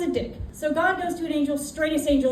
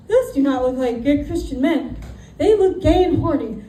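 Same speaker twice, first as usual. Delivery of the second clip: a touch louder, the angel half-panicked; alarmed angel; 6 dB louder than usual. Retold or imitated. Angel to himself about the crowd, mildly alarmed. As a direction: voice the angel spotting trouble, louder.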